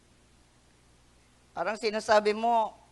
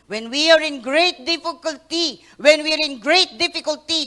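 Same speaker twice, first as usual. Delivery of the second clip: preaching emphasis; 6 semitones higher, 10 dB louder than usual. Acted. Repeated line said louder second time.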